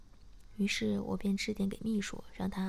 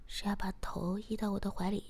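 ordinary delivery, nothing marked

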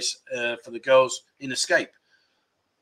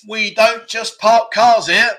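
high, loud, slow and emphatic; quiz clue announcement; 7 semitones higher, 7 dB louder, slower than usual. Acted. Quiz host giving clue number two, loud and drawn-out. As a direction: proclaim the riddle with drawn-out words.

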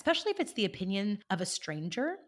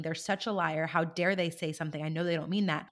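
ordinary delivery

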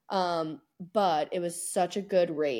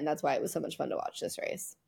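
ordinary talk

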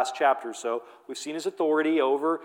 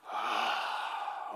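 long audible exasperated sigh; weary sigh; 11 dB quieter than usual. Acted. Preacher acting out Peter's weary sigh. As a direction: breathe out the frustration. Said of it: "He sighs".

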